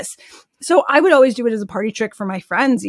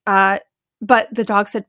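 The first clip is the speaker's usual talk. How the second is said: usual speaking voice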